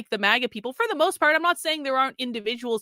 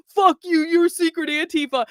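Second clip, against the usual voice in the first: higher, louder mock snarl at Carlin; mock angry right-winger; 5 semitones higher, 4 dB louder than usual. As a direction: bark it like an angry online rightist. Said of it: "the right is like".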